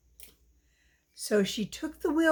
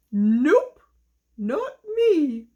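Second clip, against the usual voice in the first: lightly voiced little dog, perky and refusing; a cheerful dog brushing off the hen; doggy voice; 3 semitones higher, 5 dB louder, much slower than usual. Dog character in the story.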